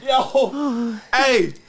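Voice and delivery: high laughing call for help. Real, not acted. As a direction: yell it out between laughs. Calling out while laughing.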